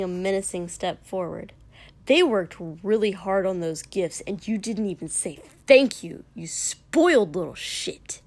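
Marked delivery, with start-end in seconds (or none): 2.1-8.2 loud, heated telling-off; angry scolding. A narrator's reading. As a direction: rip into him for his rudeness. Katsuki scolding Shoto angrily.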